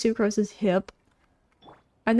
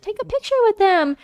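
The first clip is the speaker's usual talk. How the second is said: Paimon voice; 10 semitones higher, 4 dB louder than usual. Retold or imitated. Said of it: "she's like".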